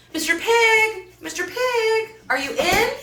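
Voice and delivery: high sing-song calling at the door. Acted. Wolf calling at the stick house door. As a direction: call out sweetly to the pig. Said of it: "So I called".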